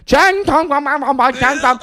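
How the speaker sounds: high, loud mock Vietnamese gibberish to make coworkers laugh; joking mock Vietnamese. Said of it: "like, being funny".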